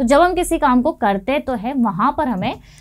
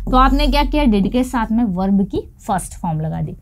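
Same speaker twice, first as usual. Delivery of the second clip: ordinary delivery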